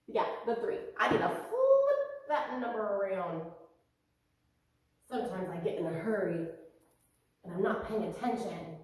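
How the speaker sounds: normal talking voice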